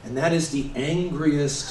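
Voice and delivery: ordinary talk, nothing marked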